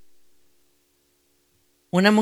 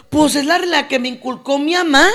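higher-pitched mimicked excuse; naive believer's answer; 6 semitones higher than usual. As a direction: answer like someone repeating what mom taught. Acting a habit believer's excuse.

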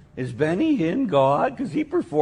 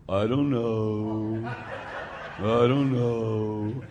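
low, very slow mock-dopey drone; 3 semitones lower, 6 dB quieter, much slower than usual. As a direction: imitate a dull teen, stretching every word. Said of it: "the number one answer amongst high school kids".

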